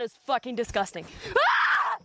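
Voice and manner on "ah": sudden high scream; sharp, high "ah" of alarm; high-pitched scream. Acted. Screams after tasting the coffee.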